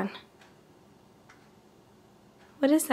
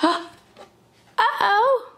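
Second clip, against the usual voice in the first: playful uh-oh; 8 semitones higher, 3 dB louder, much slower than usual. Pretend. Playful surprise with toddler.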